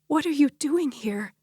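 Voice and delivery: high, strained croak. Acted. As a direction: croak it out in shock. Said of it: "Luna croaked".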